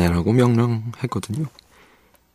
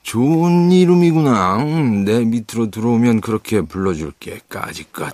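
expansive, pitched-up approval; Hook humoring a boy; 3 semitones higher, 5 dB louder than usual. Acted. Hook welcomes John under him, grandly indulgent. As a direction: grant it grandly, then toss off the end.

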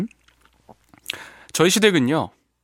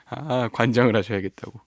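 ordinary talk

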